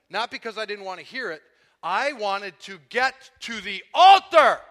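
loud, building preacher's fervor; preaching at full force. Acted. Pastor stirring the church about his conversion, fervent loud preaching. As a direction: pour it out to the congregation with rising passion.

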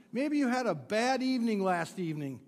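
ordinary talk

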